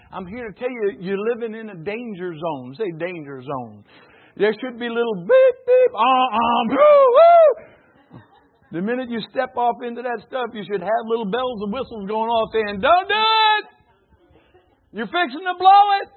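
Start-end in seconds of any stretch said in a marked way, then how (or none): 4.4-8.2 alarm noises. Pretend. Preacher imitates a danger alarm for the congregation, loud and high. 12.8-16.1 loud, high alarmed warning; warning shout. Pretend. Preacher voices the inner alarm warning a sinner, loud and high.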